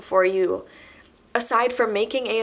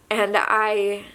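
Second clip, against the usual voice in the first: ordinary delivery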